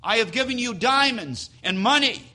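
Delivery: indignant husband. Acted. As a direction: start clipped and fast, swell into shouting. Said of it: "says something like this".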